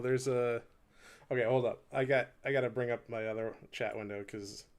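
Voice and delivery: normal talking voice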